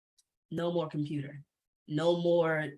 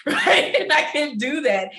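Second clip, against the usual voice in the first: laughing; 7 semitones higher, 8 dB louder than usual. Real, not acted. Laughing about crying anytime.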